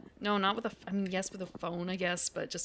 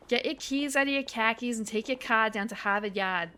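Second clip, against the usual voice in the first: put-on broad Boston accent with dropped r's; playful Boston accent tongue twister. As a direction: lay on a thick Boston accent, dropping every r. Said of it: "Boston has a different accent".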